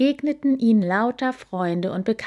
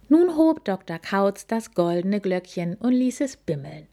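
plain reading voice

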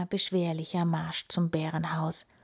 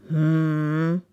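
drawn-out humming growl; bear grumble; 2 semitones lower, 6 dB louder than usual. A narrator's reading. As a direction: grumble while considering. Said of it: "brummelte der Bär".

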